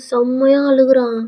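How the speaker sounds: plain reading voice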